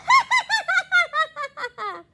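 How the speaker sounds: loud high cackling laugh; group laughing. A narrator's reading. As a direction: burst out laughing together. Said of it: "they started to laugh".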